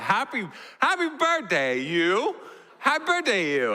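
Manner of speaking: put-on high, sing-song toddler squeal; imitating excited toddler. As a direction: chirp it like a thrilled two-year-old. Toddler greets cookie excitedly.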